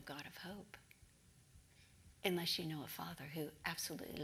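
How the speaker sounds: soft, hushed sincerity with long pauses; near-whispered assurance of hope; tender reassurance. Real, not acted. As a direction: offer comfort quietly, letting each phrase settle. Solemn reassurance to the listeners.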